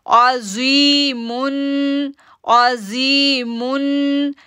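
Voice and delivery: अजीमुन chanted very slowly with the जी held long; tajweed recitation, slow and held; drawn-out recitation. Shown as a demonstration. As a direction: hold the जी in अजीमुन for two beats. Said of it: "अब जी को खींचेंगे".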